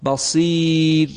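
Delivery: بصیر slow, with a thin, light final ra; thin ra recitation. Shown as a demonstration. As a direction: pause on بصیر with a fine ra. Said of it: "بخوانیم". (بصیر را با راء نازک وقف کن)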